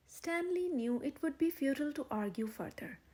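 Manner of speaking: ordinary delivery, nothing marked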